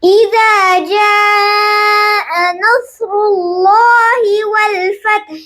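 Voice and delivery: slow, melodic tajweed recitation; Quran recitation. Shown as a demonstration. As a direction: chant each verse slowly, like a teacher. Teacher recites Surah An-Nasr. (استاد کی سست تجوید والی تلاوت)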